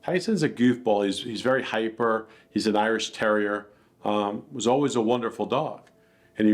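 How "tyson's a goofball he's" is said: ordinary delivery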